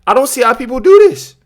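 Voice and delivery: high, loud, stammering disbelief; incredulous exasperation. Real, not acted. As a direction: stumble over words in loud, baffled outrage. Incredulous reaction to senator's clip.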